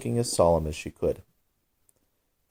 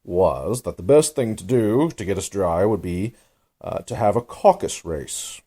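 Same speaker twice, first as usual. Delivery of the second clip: offended; 3 dB louder than usual. A narrator's reading. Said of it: "in an offended tone".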